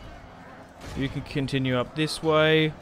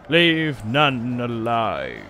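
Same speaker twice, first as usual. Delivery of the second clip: orc warlord voice; much slower than usual. Acted. Game unit voice lines.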